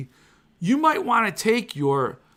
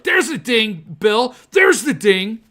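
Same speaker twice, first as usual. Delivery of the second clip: higher, louder gleeful calling out; mock triumphant; 6 semitones higher, 6 dB louder, slower than usual. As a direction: call out proudly to Bill, hammed-up. Mock triumph after denting book.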